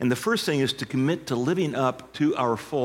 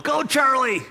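yelled command; 12 semitones higher, 5 dB louder than usual. Retold or imitated. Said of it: "the farmer yelled".

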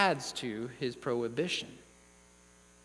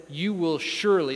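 SURELY punched louder; firm decree leaning on "surely"; stress on SURELY; 5 dB louder than usual. Acted. Preacher quoting God's warning, emphatic on "surely". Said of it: "it's the same type of word".

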